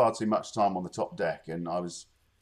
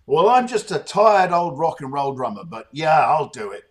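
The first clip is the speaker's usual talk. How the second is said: self-deprecating drummer; 6 semitones higher, 10 dB louder than usual. Retold or imitated. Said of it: "Something charming and sort of self-deprecatory like that".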